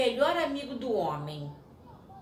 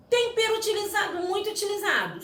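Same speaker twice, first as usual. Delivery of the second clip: high, fast eager reveal; excited reveal; 12 semitones higher, faster than usual. Real, not acted. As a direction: build up the answer with glee. Revealing quiz answers excitedly. (revelação animada e aguda)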